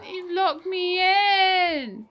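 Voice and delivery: long, high, loud mock-crying wail; wailed protest. Trapped player cries out to the one above, wailing. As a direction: drag out a high, plaintive shout.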